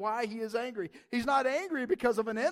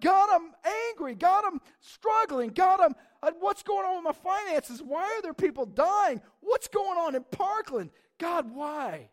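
higher, louder, shouted complaint to God; angry prayer; 7 semitones higher, 6 dB louder than usual. Shown as a demonstration. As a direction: throw it at God with full force. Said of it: "we throw it like".

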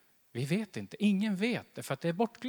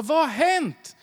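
higher, louder worried wife asking what happened; 9 semitones higher, 7 dB louder than usual. Retold or imitated. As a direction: rush in alarmed, a lightly voiced wife.

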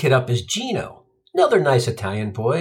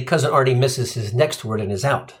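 ordinary talk, nothing marked

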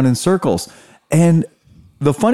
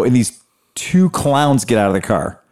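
ordinary delivery

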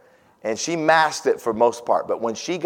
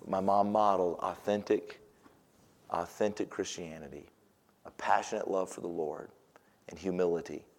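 quiet sincerity; 5 semitones lower, 12 dB quieter than usual. Real, not acted. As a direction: lay it down tenderly. Quiet summing up.

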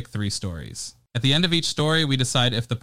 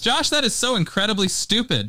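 exasperated scolding; 9 semitones higher than usual. Acted. Scolding co-host's dumb joke.

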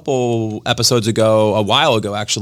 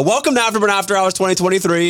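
podcast host intro; 6 semitones higher, faster than usual. Acted. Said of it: "podcast intro announcement".

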